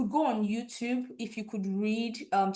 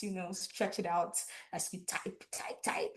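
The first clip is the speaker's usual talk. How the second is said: hushed playful aside; 3 semitones lower, 8 dB quieter than usual. Real, not acted.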